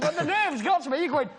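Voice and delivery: ordinary talk, nothing marked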